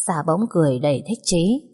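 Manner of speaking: usual speaking voice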